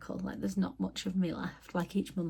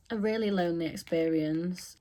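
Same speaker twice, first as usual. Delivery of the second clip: ordinary talk, nothing marked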